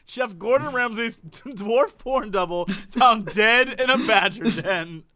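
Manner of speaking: slow, weighty news headline; headline read. Shown as a demonstration. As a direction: announce it like a newsreader. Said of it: "Let me repeat that headline".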